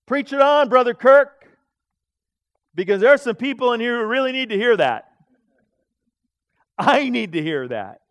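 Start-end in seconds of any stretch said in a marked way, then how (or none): 0.0-5.1 louder, smug praise from the pew; mock smug churchgoer. Proud listener praising the preacher, loud and smug. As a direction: talk yourself up as the righteous one. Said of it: "We cannot sit back and say".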